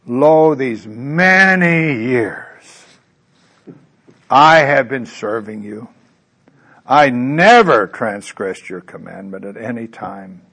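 put-on loud, slow, indignant older son; older son protesting to his father, self-righteous and loud. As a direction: proclaim your own goodness loudly. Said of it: "Notice the self-righteousness here".

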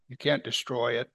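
usual speaking voice

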